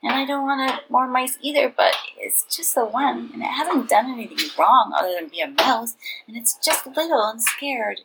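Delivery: put-on a small boy begging for mercy; pleading boy Cheng Ling.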